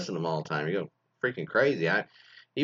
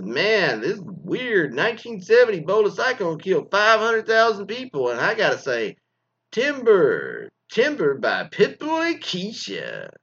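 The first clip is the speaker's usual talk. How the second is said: raised, over-the-top radio DJ patter; goofy DJ announcing a song, drawn out at the end; 7 semitones higher than usual. Acted. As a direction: ham it up like a cheesy disc jockey. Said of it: "I wanted to do a goofy D J voice".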